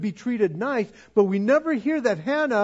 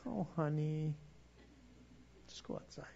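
sweet dismissive mother; 5 semitones lower, 21 dB quieter, faster than usual. Retold or imitated. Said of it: "she's probably going".

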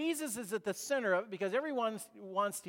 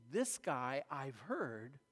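inner thought; 5 semitones lower, 7 dB quieter than usual. Retold or imitated. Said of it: "he thinks".

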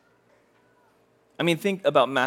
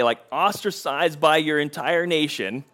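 ordinary talk

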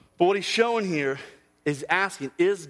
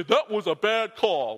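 mock confident fan; 4 semitones higher, slower than usual. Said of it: "you can just say".

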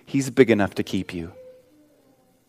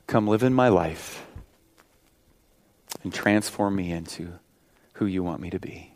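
leading a prayer; 3 dB louder than usual. Acted. Pastor praying with the congregation, earnest prayer. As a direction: pray aloud for the whole room.

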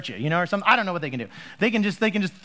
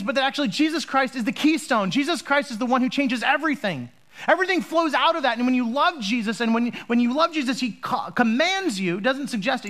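higher, rising passionate preaching; 6 semitones higher than usual. Acted. Pastor urging the congregation, higher and fervent. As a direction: build it up, proclaiming to the congregation.

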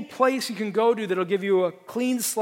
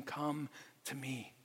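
much softer, slow, gentle "Come to me"; tender invitation; 3 semitones lower, 14 dB quieter, slower than usual. Retold or imitated. Jesus with arms open to the weary sinner, soft tender invitation. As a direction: draw them in quietly, like Jesus inviting. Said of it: "holding up his arms saying".

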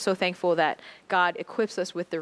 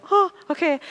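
much higher and louder; surprised oh okay; 10 semitones higher, 7 dB louder than usual. Retold or imitated. Passengers react to the announcement, much higher and louder. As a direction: jump up in surprise.

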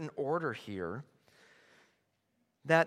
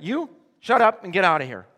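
fast, loud and higher; forceful rebuke; 4 semitones higher, 11 dB louder, faster than usual. Acted. Preacher ordering a yelling heckler out, loud and fast. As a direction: throw the heckler out sharply. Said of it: "the preacher just said".

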